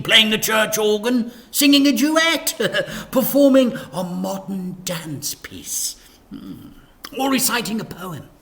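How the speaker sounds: bubbly church lady listing options; cheery vicar. A narrator's reading. Vicar listing church performances to Dad, high and cheery.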